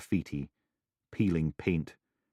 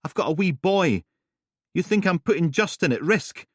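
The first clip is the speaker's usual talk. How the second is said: put-on raised, higher voice, defending her child; upset defensive; 12 semitones higher, 6 dB louder than usual. Helen snapping at the detectives, rattled and defensive. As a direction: push back hard, protective and rattled.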